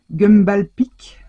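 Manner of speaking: a light English accent, hammed up for fun; mock English accent. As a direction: play up a fake English accent on the brand name. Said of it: "avec mon accent anglish".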